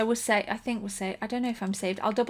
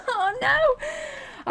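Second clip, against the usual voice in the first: very high cry of dismay; high-pitched wail; dismayed squeal. Real, not acted. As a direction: squeal it as all is lost. Character dies in game.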